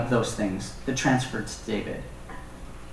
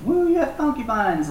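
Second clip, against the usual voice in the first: mock cheer; 11 semitones higher than usual. Mocking the kings' supposed benefit.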